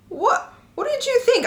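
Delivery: baffled, pitch way up; disbelief. Real, not acted.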